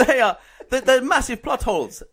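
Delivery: put-on Italian accent. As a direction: complain in hammed-up Italian, half laughing. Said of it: "Well, in Italian accent".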